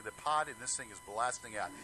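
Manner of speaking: usual speaking voice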